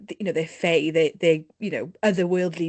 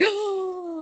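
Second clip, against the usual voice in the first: amazed gasp; 12 semitones higher, 3 dB quieter than usual. Retold or imitated. Hearing the accent, amazed.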